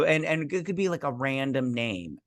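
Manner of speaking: ordinary delivery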